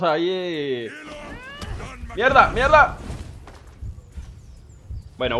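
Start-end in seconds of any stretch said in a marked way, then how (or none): 2.2-3.0 loud, high frustrated swearing; frustrated cursing. Real, not acted. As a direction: curse out loud in a panic. Fight going badly.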